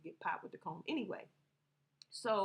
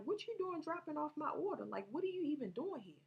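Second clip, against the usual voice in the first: retold surprise; 7 semitones higher than usual. Retold questioning of relative at door.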